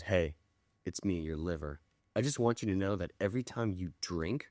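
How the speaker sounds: low, hushed, grave appeal; solemn PSA. Retold or imitated. Liver costume guy addressing drinkers in a PSA, hushed solemnity. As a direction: drop it soft and low, like a sober public warning. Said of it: "like a P S A".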